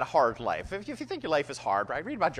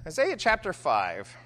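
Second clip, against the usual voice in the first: ordinary talk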